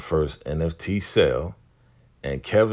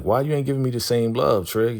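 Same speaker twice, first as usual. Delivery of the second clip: higher-pitched imitation of a hurt complaint; mock Peaches; 6 semitones higher than usual. Voicing Peaches asking Trig for the same love, higher-pitched mock voice. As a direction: ask for love in a raised voice.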